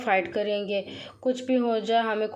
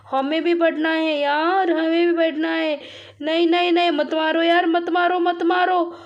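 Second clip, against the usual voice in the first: loud, high-pitched protest; frantic shouted plea, pitch well up; urgent pleading; 7 semitones higher, 6 dB louder than usual. Real, not acted. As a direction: cry out as if begging an attacker to stop. Player under attack, begging the monster.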